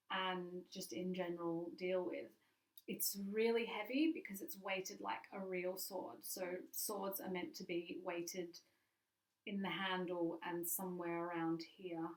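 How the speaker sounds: usual speaking voice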